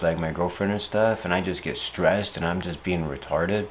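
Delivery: ordinary talk, nothing marked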